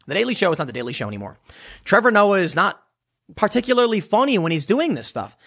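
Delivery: normal talking voice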